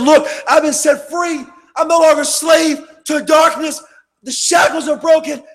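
loud, high fiery preaching; preaching at full force. Acted. Preacher warning the congregation about Satan, loud fiery preaching. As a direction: thunder it from the pulpit.

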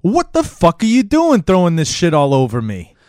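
loud, high-pitched angry confrontation; acted outrage. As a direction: blow up at the person who threw it. Improv scene, angry victim confronts culprit.

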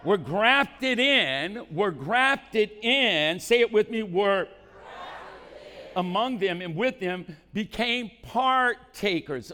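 drawn-out shouted phrase for the crowd to echo; slow preached call. Acted. Pastor leading the congregation to repeat, shouting slowly. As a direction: stretch each word and call it out.

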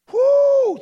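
long, loud, high "woo"; sustained high holler; joyful whoop. Real, not acted. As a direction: let out an ecstatic whoop. Preacher overcome with joy.

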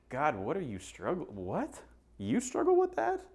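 mock disbelief leaping very high at the end; judgmental shock. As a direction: act appalled, squeaking up in disbelief. Acting a judgmental, shocked listener.

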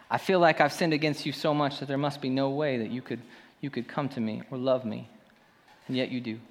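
hushed, sincere prayer voice. Real, not acted. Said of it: "pray with me in this moment".

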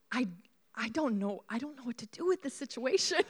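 soft, halting, at a loss; sigh, then stumble quietly through it as a baffled husband; helpless husband imitation. Husband to his crying, laughing wife, helpless and hesitant. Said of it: "he's like".

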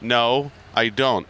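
usual speaking voice

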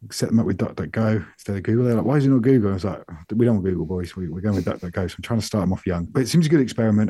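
ordinary delivery

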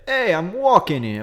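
slow, brash New York street voice; a hint of a cabbie yelling in New York; New York accent. New Yorker crossing the street, brash New York accent. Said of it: "the other side of it being like".

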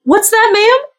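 high-pitched waitress, voice pushed up. Acted. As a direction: play a waitress in a high, lifted voice. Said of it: "and she goes".

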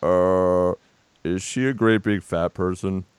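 mock Ribisi drawl, dropped low and quiet; Giovanni Ribisi impression. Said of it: "as Giovanni Ribisi?".

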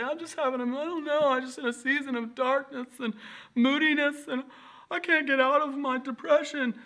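high, whiny caricature of moping; mock depressed complainer. Pastor mimicking depressed people he once dismissed, high mock moaning. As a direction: imitate a self-pitying sufferer in a high put-on voice. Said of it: "When people would say".

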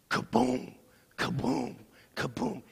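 kaboom, kaboom, kaboom as a big charging dog; dog lunging boom. Retold or imitated. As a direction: mimic the dog slamming out.

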